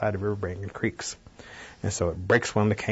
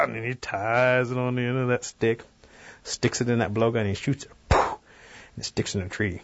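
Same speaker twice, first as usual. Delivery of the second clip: ordinary delivery, nothing marked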